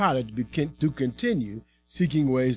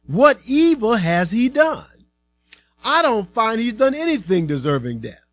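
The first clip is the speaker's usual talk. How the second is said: higher-pitched, emphatic protest; protesting Pilate; 7 semitones higher, 3 dB louder than usual. A narrator's reading. Pilate pleads Jesus' case to the crowd, protesting. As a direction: challenge them, pitched up and insistent.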